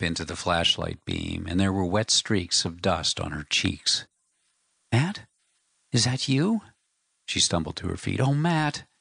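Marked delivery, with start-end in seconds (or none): 4.9-6.6 put-on raised, pleading young woman's voice; young wife, tearful. A narrator's reading. Wife finding her husband in the dark tent, tearful young woman's voice. 8.2-8.9 put-on raised, pleading young woman's voice; young wife, tearful. A narrator's reading. Wife finding her husband in the dark tent, tearful young woman's voice.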